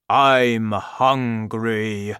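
slow, loud booming giant; giant shouting. A narrator's reading. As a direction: drag it out like a hungry giant. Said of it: "the giant shouted".

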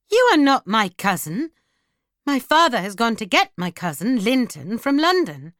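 high, indignant insistence; offended girl setting him straight; scornful denial. Acted. Girl scornfully denies the cousin.

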